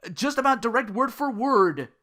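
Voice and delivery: ordinary talk, nothing marked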